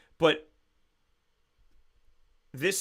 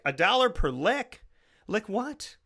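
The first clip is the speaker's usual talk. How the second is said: higher-pitched, puzzled echo as a question; incredulous dad; 7 semitones higher than usual. Acted. Imagined dad questioning his child's fundraiser, incredulous. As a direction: question it like a baffled parent.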